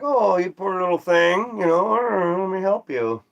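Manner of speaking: put-on high, tender baby-talk coo; talking to a lost chicken, high and tender. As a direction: soothe the little chicken like a pet. Comforting a lone chicken.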